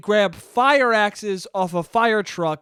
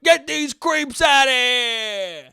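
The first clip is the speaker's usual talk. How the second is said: slow, louder, put-on cop bark; Brooklyn cop; 7 semitones higher, 3 dB louder, much slower than usual. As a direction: drag out the order like a movie cop. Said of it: "the Brooklyn cop's like".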